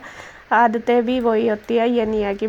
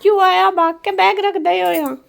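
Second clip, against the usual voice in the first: excited scolding; 7 semitones higher, 6 dB louder than usual. Real, not acted. Mother fussing at her out-of-breath son, higher and louder. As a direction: fuss at him for running all the way.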